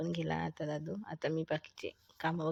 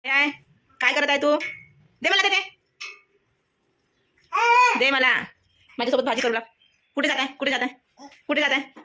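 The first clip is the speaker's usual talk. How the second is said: loud calling; 9 semitones higher, 11 dB louder, faster than usual. Real, not acted. Calling toddler away from mischief. (खोडकर बाळाला मोठ्याने हाक मारणे)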